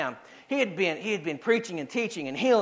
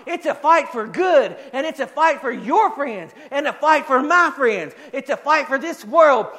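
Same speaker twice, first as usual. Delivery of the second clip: loud, emphatic rally cry from the pulpit; fired-up preacher, drawn-out stresses; impassioned preaching; 6 semitones higher, 6 dB louder than usual. Real, not acted. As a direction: preach it hard, punching the key words. Preaches at full force.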